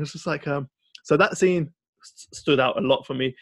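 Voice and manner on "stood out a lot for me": ordinary talk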